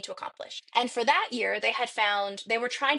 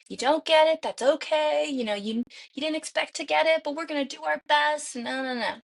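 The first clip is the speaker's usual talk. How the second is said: mirror pep talk; 5 semitones higher than usual. Retold or imitated. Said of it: "doing a pep talk in the mirror".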